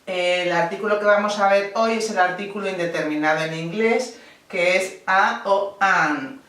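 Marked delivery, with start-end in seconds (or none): 4.5-6.4 slow demo. Shown as a demonstration. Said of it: "teacher demonstrates articles slowly".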